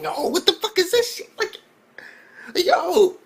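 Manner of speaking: high-pitched, incredulous outburst; baffled disbelief. Acted. Reviewer reacting to a crazy first episode, baffled disbelief. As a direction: blurt it out high in utter bafflement.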